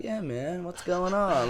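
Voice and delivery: soft laid-back dude impression; quiet, spaced-out greeting; zoned-out dude. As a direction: imitate a guy in his own little world. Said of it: "Like, that kind of guy".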